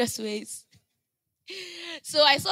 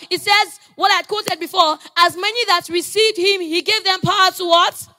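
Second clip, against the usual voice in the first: high, loud, urgent sermon delivery; full-force preaching; 5 semitones higher, 6 dB louder than usual. Real, not acted. Preacher declaring God will not forget you, full-force preaching. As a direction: proclaim it to the whole congregation.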